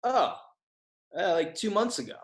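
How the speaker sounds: high "oh", then a hesitant answer; sheepish realization. Retold or imitated. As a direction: act out it dawning on you, mildly. Retold sheepish realisation, first answer.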